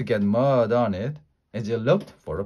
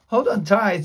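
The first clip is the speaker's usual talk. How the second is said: urgent command; 7 semitones higher, 4 dB louder than usual. A narrator's reading. Urgent rescue as beasts approach.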